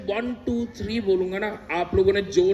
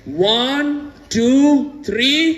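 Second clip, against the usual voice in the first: shouted count; 5 dB louder, much slower than usual. Real, not acted.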